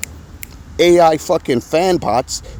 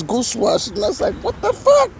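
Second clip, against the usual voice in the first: ordinary delivery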